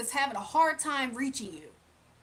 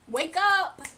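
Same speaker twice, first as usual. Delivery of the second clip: loud sharp call, louder; wake-up call; 4 semitones higher, 7 dB louder than usual. Real, not acted. To the ex who won't let go, loud wake-up call. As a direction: snap them out of it. Scolding the ex to face reality.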